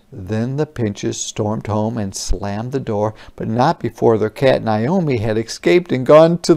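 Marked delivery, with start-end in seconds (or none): none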